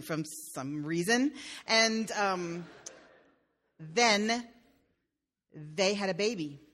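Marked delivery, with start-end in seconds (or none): none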